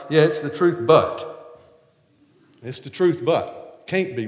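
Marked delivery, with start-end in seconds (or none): 0.1-1.2 loud, doubtful yes-but; sceptical yes-but. Retold or imitated. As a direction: push it back louder, unconvinced. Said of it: "I was like".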